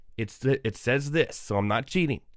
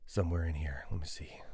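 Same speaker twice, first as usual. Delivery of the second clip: whispering; 6 semitones lower, 9 dB quieter than usual. Real, not acted. Searching the screen for the order.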